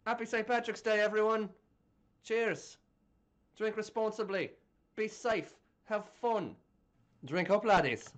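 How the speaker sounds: hammed-up Irish storyteller; playful stage-Irish brogue; put-on Irish accent. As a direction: review the film in a mock Irish accent.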